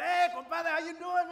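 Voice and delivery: big booming hello to a friend; loud greeting. Retold or imitated. Dad greeting visiting relatives, loud and hearty. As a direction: greet the compadre at full volume. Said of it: "My dad just talked loud".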